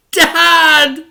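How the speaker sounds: high, loud cry for Dad; a boy shouting for his father. A narrator's reading. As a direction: call out to Dad in alarm. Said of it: "shouted the boy".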